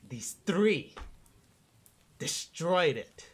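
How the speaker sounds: bitter royal accusation, whispering then crying out; angry queen. Acted. DM voices queen confronting party.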